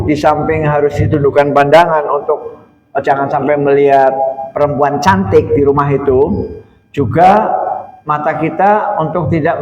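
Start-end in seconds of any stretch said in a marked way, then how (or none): none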